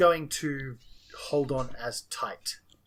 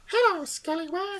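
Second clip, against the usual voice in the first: very high-pitched little gnome voice; high munchkin greeting. Acted. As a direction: squeak it out like a cartoon munchkin. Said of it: "I've got this munchkin voice in my head".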